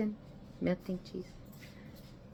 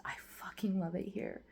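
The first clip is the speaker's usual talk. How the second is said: whisper; 9 dB quieter than usual. Real, not acted. Whispered aside to camera.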